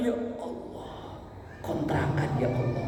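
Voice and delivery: breathy, voiceless little prayer; whispered plea. Retold or imitated. Preacher mimics a worried man praying in a whisper. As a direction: whisper it like a desperate sigh to God. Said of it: "Itu ya Allahnya mantep loh".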